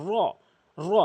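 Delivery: র made heavy with rounded mouth; full, rounded r. Shown as a demonstration. Teacher showing the heavy letter, rounded full র. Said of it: "পুর করে, মুখ গোলাকার করে".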